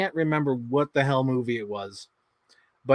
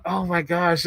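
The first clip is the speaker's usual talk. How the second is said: ordinary talk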